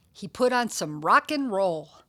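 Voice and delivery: ordinary delivery, nothing marked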